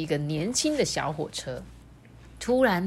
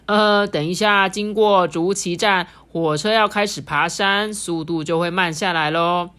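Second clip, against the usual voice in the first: conductor announcement; 6 dB louder than usual. Acted. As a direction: announce it over the train speakers. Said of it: "列车长就广播了".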